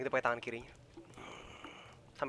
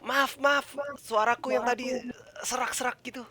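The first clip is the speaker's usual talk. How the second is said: tipsy patron; 11 semitones higher, 5 dB louder than usual. Acted. Character apologises to the bartender.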